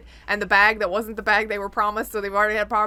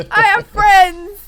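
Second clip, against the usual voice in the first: loud, high, stretched-out boast; mock triumphant cry; 10 semitones higher, 12 dB louder, much slower than usual. Speaker boasting of gadget friends, gleeful mock shout. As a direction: shout it proudly, dragging every word.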